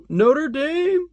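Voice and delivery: very high, drawn-out football cry; mock battle cry. Coach tackling like in his field days, mock battle cry. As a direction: hold the last word high like a charge.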